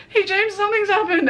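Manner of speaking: high, strained retold plea; uneasy phone call. Retold emergency call to brother.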